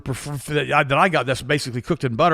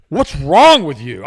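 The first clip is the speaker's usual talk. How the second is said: exasperated; 9 dB louder, much slower than usual. Retold or imitated. Exasperated retort to patient.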